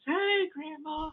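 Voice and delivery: put-on high, slow little-kid voice; high child's voice calling to grandma; small child voice. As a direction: call out sweetly like a tiny child. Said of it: "it's like a very small child. You know, the voice goes".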